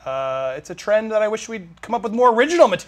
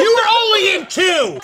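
loud, high mock outrage; shouting; 11 semitones higher, 6 dB louder than usual. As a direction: yell it in fake disbelief. Yelling at the fake Owen.

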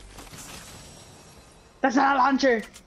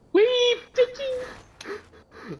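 squeaky wee; much slower than usual. Pretend. Squeaky gleeful cheer.

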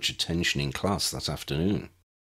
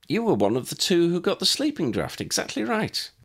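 put-on light, higher girlish voice, lifting to the end; a bright young girl keen to impress; young Lydia, eager; 12 semitones higher than usual. A narrator's reading. Lydia greets the first-year girl who brewed it right, eagerly.

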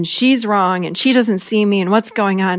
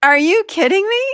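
pitched-up exasperated disbelief; are you kidding me, higher; incredulous anger; 10 semitones higher than usual. Retold or imitated. Angry at her husband, higher incredulous outburst. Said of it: "And I'm like".

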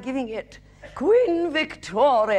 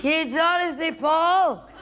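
put-on drunk woman heckling the stage, slow, slightly higher; lightly voiced boozy heckler jeering; 4 semitones higher, much slower than usual. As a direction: drunk heckler mocking the Irish accent. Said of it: "This woman".

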